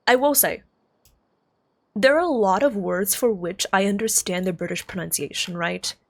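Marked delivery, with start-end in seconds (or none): none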